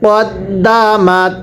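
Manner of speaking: কদ্দামাত drawn out slowly, short ক then doubled দ্দ; slow spelled-out Quran reading. Shown as a demonstration. Teacher models কদ্দামাত slowly for the class. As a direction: stretch each sound of কদ্দামাত, doubling the দ. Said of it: "সবাই একসাথে আওয়াজ দিয়ে পড়বেন".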